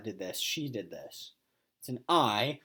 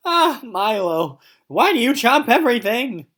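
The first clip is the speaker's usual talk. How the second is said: exasperated; 10 semitones higher, 12 dB louder than usual. Acted. Owner fed up with Milo chewing, exasperated and loud. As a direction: throw up your hands at the dog. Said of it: "I asked, exasperated".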